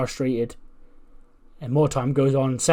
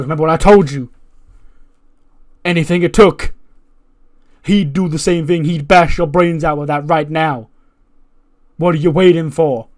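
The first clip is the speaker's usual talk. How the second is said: louder, insistent manager voice pushing him to use the chair; MVP urging him on; 3 semitones higher, 8 dB louder than usual. Retold or imitated. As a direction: tempt him into it, loud and pushy. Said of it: "And he goes".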